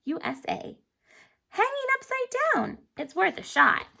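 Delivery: plain reading voice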